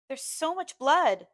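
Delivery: slow, drawn-out moan. A narrator's reading. A bleeding woman moaning to her maid, slow and pained. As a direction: moan it out in pain. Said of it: "she moans".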